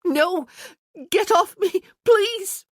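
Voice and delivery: high, loud, terrified pleading scream; fake scream. Isabel fakes an assault to lure the archers, screaming. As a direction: shriek as if attacked. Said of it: "She screamed".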